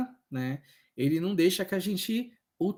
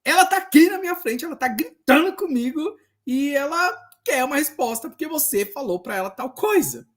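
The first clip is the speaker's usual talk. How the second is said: higher, louder urgent report; retold urgent call; 9 semitones higher, 5 dB louder than usual. Tired social worker phones Daniel about a shouting patient, high and urgent. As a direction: sound stressed, patient yelling at you. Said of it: "eu falei".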